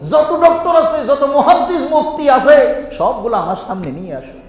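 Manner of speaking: loud, high boastful taunt with a laugh; boastful challenger. Retold or imitated. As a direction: crow a challenge at all the scholars. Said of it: "হে তো কয়".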